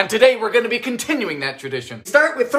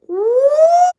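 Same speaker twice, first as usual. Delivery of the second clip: a high, loud squeal of delight; 7 dB louder than usual. Real, not acted. Host tastes the baked apple and squeals high with delight.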